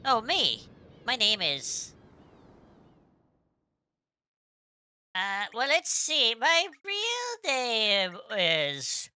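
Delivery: attempted Australian accent, lively swooping pitch; Kid. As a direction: put on a perky, makeshift Australian accent. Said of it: "if I could do an Elstarian accent".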